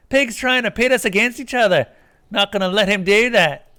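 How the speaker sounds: high, loud defiant voice; angry Kuno. Acted. Kuno rallying Kuno S against the player, angry. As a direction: turn on the cop hard.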